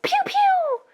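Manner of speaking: high, loud pew pew; blaster noise. Acted. Han shooting the dude, high mock blaster noise. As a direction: spit out a cartoon laser blast.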